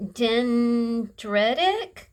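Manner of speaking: "dendritic" slow and tentative, rising at the end; "dendritic" drawn out as if unsure of it; unsure pronunciation. Real, not acted. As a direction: sound out "dendritic" slowly with a questioning lift. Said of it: "I might be pronouncing that wrong".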